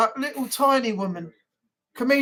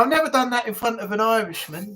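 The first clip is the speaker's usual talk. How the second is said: ordinary talk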